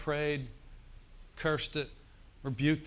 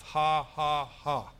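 faith laugh; 4 dB louder, much slower than usual. Shown as a demonstration. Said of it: "thou shalt laugh".

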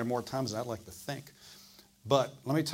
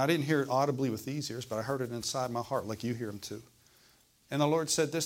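normal talking voice

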